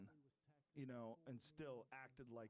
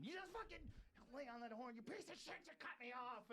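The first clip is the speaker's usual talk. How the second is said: much higher, raised-voice road rage yelling; mock road rage; 10 semitones higher than usual. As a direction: lean on the horn and scream at the driver. Acting road rage honking.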